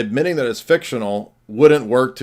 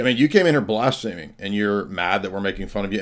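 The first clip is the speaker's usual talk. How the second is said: normal talking voice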